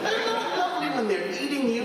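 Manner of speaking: high, laughing mock retort; mock exasperated retort. Answering Jane about polar bears, high laughing retort. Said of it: "I'm like".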